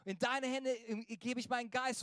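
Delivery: moderately shouted plea; retold cry out. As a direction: cry out to a father, measured. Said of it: "schrie er".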